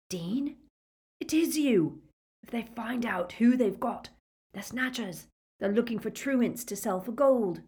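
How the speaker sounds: high, hushed urgent voice; urgent captive. A narrator's reading. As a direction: warn a fellow prisoner urgently. Prisoners whisper together, voiced.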